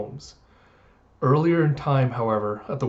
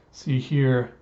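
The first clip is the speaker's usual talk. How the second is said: normal talking voice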